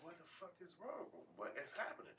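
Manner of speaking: high, softened confusion; bewildered. Retold or imitated. Dad wondering what is wrong with kid, high bewilderment. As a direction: pitch it up, puzzled and hushed.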